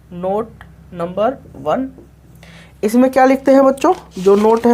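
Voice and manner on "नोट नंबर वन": slow dictation. Shown as a demonstration. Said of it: "note में बच्चों यही लिख लेते हैं".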